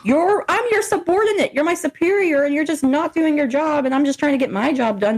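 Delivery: high-pitched exasperated pleading; indignant subordinate fed up with the boss; exasperated retort. Retold or imitated. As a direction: argue your case, voice pushed up high. Acts out telling off the manager.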